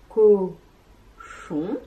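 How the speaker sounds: "cochon" as co... chon, last syllable rising; stretched "co", pause, lifted "chon"; split into syllables. Shown as a demonstration. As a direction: say "cochon" in two separate beats. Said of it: "des syllabes qui composent".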